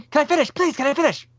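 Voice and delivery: high, pleading cartoon kid voice; South Park quote. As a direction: beg to finish, high and whiny. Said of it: "You don't remember that from the South Park movie?".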